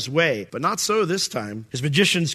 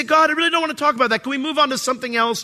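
mock whiny plea; 10 semitones higher, faster than usual. Preacher imitating his own pleading.

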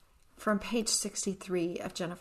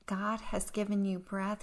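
ordinary talk

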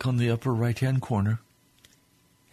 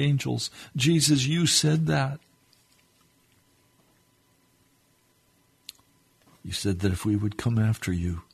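fervent prayer; 2 semitones higher, 3 dB louder than usual. Real, not acted. Preacher praying to the Lord for his listeners, fervent prayer. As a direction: pray earnestly, hushed then rising to a fervent cry.

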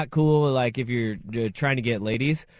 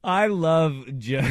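ordinary talk

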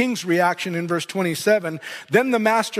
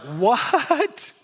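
shocked what; 4 semitones higher than usual. Retold or imitated. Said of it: "other people are like".

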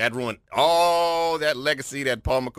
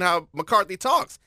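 ordinary talk

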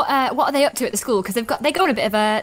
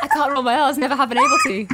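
laughing; 3 semitones higher than usual. Real, not acted.

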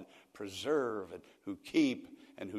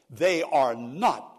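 slow, loud, higher flat refusal; irate denial; 3 semitones higher, 14 dB louder, much slower than usual. Retold or imitated. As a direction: daughter hearing the divorce news, slow loud outrage. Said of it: "she became almost irate".

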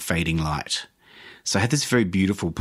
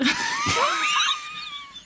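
wild high laugh that breaks into a cry; hysterical shrieking laugh. Real, not acted. Losing her mind on the ship, shrieking laugh. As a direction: shriek with laughter like you've lost it. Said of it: "lost my mind".

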